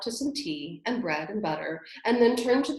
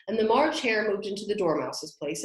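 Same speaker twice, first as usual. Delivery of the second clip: ordinary delivery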